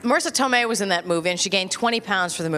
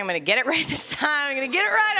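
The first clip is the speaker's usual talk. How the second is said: hopeful inner voice; 6 semitones higher than usual. Retold or imitated. Self-talk in the coffee line.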